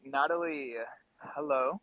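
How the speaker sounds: ordinary talk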